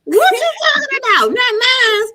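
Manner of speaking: high, loud shriek of disbelief; shocked disbelief. Acted. Mother hearing her son's teacher called, shrieking in disbelief. Acted outraged reply to the call.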